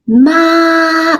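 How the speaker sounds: ma held high and level; first tone. Shown as a demonstration. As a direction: keep ma high and flat. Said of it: "that's the first tone".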